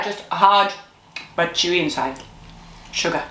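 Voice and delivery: usual speaking voice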